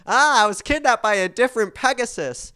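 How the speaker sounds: a lightly put-on breathless reveal; a hammed-up soap-opera character; mock returning character. [name] mocking lazy writing, hammed-up character voice.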